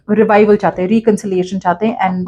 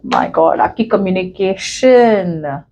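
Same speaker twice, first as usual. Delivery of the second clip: ordinary talk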